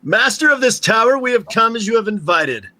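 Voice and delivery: loud, high commanding call. Acted. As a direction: call up to the tower boldly. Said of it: "raises his voice".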